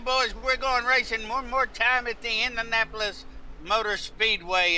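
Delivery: excited race call; motorsport commentator. Acted. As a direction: call the restart with energy. Live restart call.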